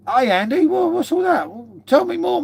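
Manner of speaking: high, excited squeal of surprise; surprised. Real, not acted. Greeting Andy after hearing of free beer, high surprised delight. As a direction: jump up in delighted disbelief.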